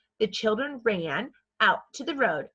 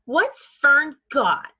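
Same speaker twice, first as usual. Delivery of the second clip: put-on demanding boy; 6 semitones higher, 8 dB louder, much slower than usual. Avery demanding in story.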